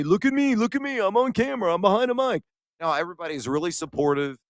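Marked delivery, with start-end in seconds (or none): 0.0-2.4 much higher, bragging sing-song; mock show-off. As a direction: parody a self-important TV guy. Mocking an egotistical broadcaster.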